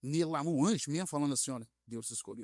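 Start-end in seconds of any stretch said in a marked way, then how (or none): 1.5-2.4 low, soft pastor speaking for God; hushed, low, angelic solemnity; pastor's beautiful voice. Retold or imitated. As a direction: imitate a pastor gently delivering God's word. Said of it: "com a voz bonita de parecer que Deus tava nele".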